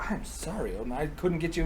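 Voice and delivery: soft, mock-sheepish apology; apologetic. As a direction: play a quietly contrite giant. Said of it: "Braugi's just apologetic as hell".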